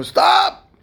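high shouted protest, fading on repeat; exasperated yell. Retold or imitated. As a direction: cry out at a noisy roommate. Roommate yells at screaming patient.

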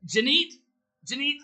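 loud, high summoning call; rising, loud call of the name. Acted. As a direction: call her name across the room. Calling out to summon the spellbook.

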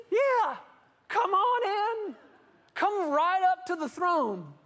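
high, loud, slow beckoning; God's voice. Retold or imitated. God beckons people up to the throne, high and loud. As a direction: wave them right up to the throne.

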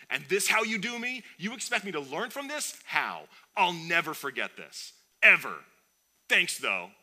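high, loud, hammed-up bitterness; mock salty rant. Receiver blaming God for the dropped pass, mock salty. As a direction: read an all-caps tweet with exaggerated saltiness. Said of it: "grade A Himalayan salt all over this".

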